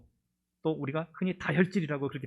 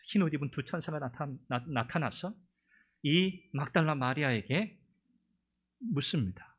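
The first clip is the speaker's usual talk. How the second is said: ordinary talk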